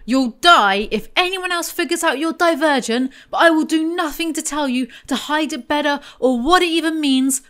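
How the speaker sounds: high, mock-exasperated character voice; exaggerated mimicry. Mocking a character's useless warning.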